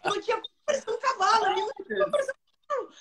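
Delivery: high, quick words breaking into laughter; laughing hard. Real, not acted. Telling the podcast hosts about the horse, laughing helplessly. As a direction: laugh through it, barely able to speak.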